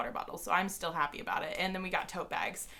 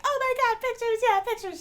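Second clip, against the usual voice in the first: much higher, thrilled fan voice; excited squeal; 5 dB louder than usual. Retold or imitated. Fans thrilled when [name] offers pictures, high excited squeal. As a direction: squeal with delight at the news. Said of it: "And we were like".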